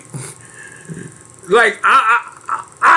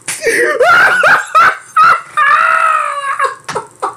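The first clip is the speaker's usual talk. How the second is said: long, high-pitched cackle; real uncontrollable laughter; helpless laughing fit; 14 semitones higher than usual. Real, not acted. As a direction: laugh till the voice squeals. Breaks up over the name.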